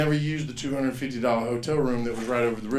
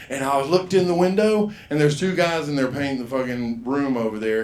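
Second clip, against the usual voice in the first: ordinary talk, nothing marked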